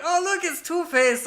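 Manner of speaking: high, drawn-out mock taunt; teasing schoolkids. Schoolkids teasing him, retold.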